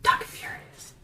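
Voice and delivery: hushed stagey whisper; very soft, voiceless title read; whisper like Dom. Acted. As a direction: whisper it like a catchphrase. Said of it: "whisper like Dom".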